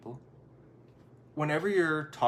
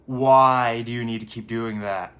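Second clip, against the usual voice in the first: exasperated question; 10 dB louder, slower than usual. Retold or imitated. Partner's exasperated question quoted.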